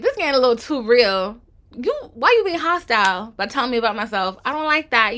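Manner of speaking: loud, affronted outburst; indignant. Retold or imitated. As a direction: play it offended and defensive. Quoting own reaction to hostility.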